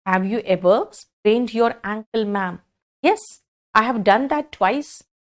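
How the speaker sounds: drawn out, word by word; slow model dialogue. Shown as a demonstration. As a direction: show the exchange slowly. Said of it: "हर्ष, ऐसे बोलोगे".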